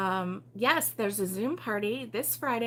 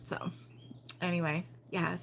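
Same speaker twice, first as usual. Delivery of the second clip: ordinary talk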